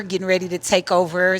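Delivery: ordinary delivery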